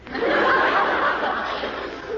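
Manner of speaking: a breathy giggle at his silly line; amused laugh. Acted. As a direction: laugh him off fondly. Girlfriend laughs at his mixup.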